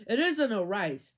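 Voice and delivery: usual speaking voice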